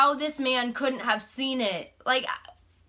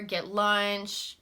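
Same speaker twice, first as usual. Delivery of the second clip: ordinary talk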